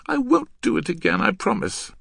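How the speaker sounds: put-on high girlish plea; pleading girl. Girl apologising to her angry partner, pleading.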